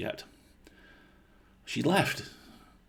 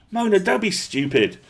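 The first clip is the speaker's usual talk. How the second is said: urgent plea; 12 semitones higher, 6 dB louder than usual. A narrator's reading. Boy tries to stop her.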